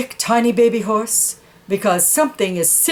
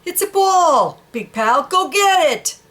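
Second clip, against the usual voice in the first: high and bossy; horse; 6 semitones higher than usual. Acted. Horse telling the dog to fetch the ball, high. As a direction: order him to fetch.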